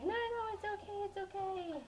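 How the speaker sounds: high, sing-song reassurance; reassuring stepmom. Retold or imitated. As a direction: soothe a scared child. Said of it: "And she's like".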